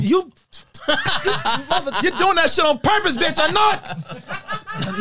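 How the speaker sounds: high laughing accusation; playful outrage at a prank; laughing mock outrage. Real, not acted. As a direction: accuse him while laughing hard.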